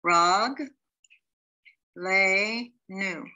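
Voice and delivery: Rag, Le, Nu split into slow separate syllables. Shown as a demonstration. Teacher sounding out syllables for Hebrew students. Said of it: "So the first word is".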